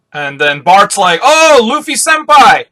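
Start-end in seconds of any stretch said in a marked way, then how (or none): 1.2-2.6 very high, loud, drawn-out adoration; hammed-up starstruck cry; fanboy gushing. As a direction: squeal to your idol, stretching it out. Bartolomeo adoringly greets his idol.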